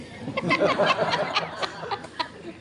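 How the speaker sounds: a big burst of real laughter; hearty laugh. Real, not acted. Laughs at being a gift.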